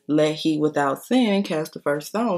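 ordinary talk

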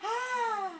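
high, loud scream of fright; frightened scream. Acted. The scared oak crying out at the lightning, shrieking. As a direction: shriek like a terrified tree at a lightning flash.

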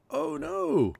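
high cry of dismay; sudden shocked yelp. Real, not acted. Lightning hit his chest.

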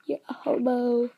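ordinary talk

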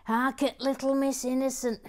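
hammed-up camp tutting; camp mock indignation. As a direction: sneer it like a camp gossip. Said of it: "Gary Blair says".

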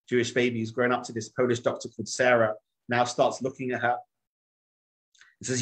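ordinary delivery

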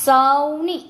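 સૌની loud and high, first syllable held; slow word demonstration. Shown as a demonstration. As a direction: stretch સૌ in સૌની, rising at the end. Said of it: "જોડણી ઉપર જોજો".

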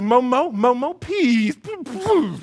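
put-on high, loud drawn-out baby plea; begging toddler. Toddler begging dad with cheeks full, high and insistent. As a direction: imitate a toddler begging through puffed cheeks. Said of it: "and it's all puffed up".

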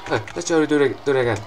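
usual speaking voice

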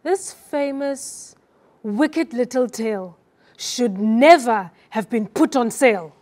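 slow start, short pauses, loud stress on NEVER; pauses and stresses. Shown as a demonstration. Student performs for her coach, irritated pauses before WICKED, stress on NEVER. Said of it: "there is a hesitant pause before wicked".

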